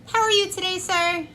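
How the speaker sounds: high-pitched customer-service hello; retold friendly shop-worker voice; friendly greeting. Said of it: "supposed to be friendly".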